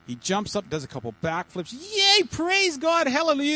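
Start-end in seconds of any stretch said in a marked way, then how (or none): 1.7-3.6 loud, high whoop of praise; acted hallelujah celebration; jubilant cheer. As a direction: shout it out, overjoyed at being healed. Healed man cheers in joke.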